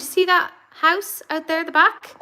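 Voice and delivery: usual speaking voice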